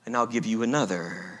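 soft, low, tender promise; hushed kindness, voice dropped; gentle. A narrator's reading. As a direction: finish the offer gently and quietly. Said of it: "he said gently".

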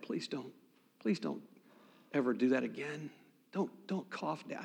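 very soft, halting plea to a parent; quiet, embarrassed begging; hushed pleading. Retold or imitated. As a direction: beg under your breath, in short bursts. Child pleading with dad in church.

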